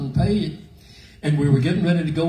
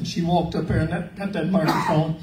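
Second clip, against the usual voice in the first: usual speaking voice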